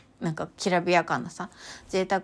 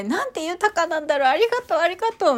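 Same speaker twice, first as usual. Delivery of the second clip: rapturous thanks; 9 semitones higher, faster than usual. Retold or imitated. Thanking aloud on the bridge.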